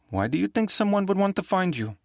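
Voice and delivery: high, suspicious question; sharp woman. Acted. Rita questioning Nick on the phone, high and suspicious. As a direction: probe him with suspicion.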